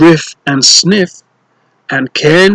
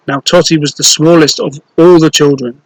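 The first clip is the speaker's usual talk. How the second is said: ordinary delivery, nothing marked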